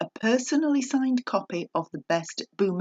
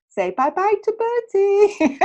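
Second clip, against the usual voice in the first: put-on high, sing-song baby talk, much higher and louder; 11 semitones higher, 5 dB louder than usual. Host waving the teddy bear at listeners, high playful baby talk. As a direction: coo it like showing a toy to a toddler.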